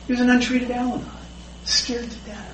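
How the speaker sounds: ordinary talk, nothing marked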